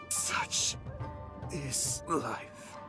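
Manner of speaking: whispered first word, then faint fading finish; halting, expiring words; dying resignation. Acted. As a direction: let it out on a last breath. Game character's dying words.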